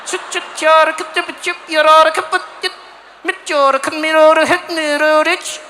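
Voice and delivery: high, loud mock-Swedish gibberish; curt Swedish. Swedes rushing through talk in freezing cold, curt tight-mouthed mock Swedish. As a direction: rattle out tight-mouthed fake Swedish syllables. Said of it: "you can't mess around out there".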